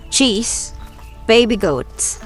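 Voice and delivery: ordinary delivery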